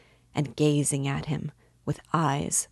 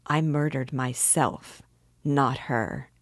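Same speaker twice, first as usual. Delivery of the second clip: plain reading voice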